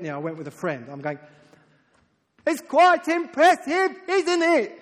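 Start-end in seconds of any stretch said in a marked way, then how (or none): 2.4-4.8 high, slow, very loud acted shout; shouting over noise. Preacher to a friend at Niagara Falls, shouting over the roar. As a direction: yell to be heard over a roaring waterfall.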